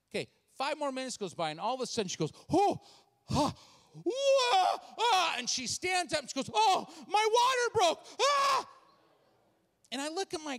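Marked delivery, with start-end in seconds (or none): 2.5-5.4 gasps rising to high, loud wails; acted labor pain cries. Woman hit by contractions mid-meeting, rising pained cries. 6.5-8.7 high, loud, drawn-out wail; acted alarmed cry. Woman tells pastor her water broke, loud pained cry. Woman in labor stands, cries out.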